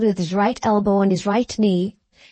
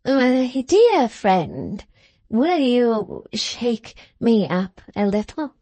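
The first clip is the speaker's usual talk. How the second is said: put-on very slow, feeble old man's plea; gasping old man; much slower than usual. Old man prostrate in his chair asking his visitor for help, gasping. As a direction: plead weakly, winded, word by word. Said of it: "gasps the old man, prostrate in his chair".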